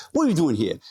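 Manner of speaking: put-on Sopranos accent. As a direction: imitate her Jersey mobster accent. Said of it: "that's how she talks, straight out of The Sopranos".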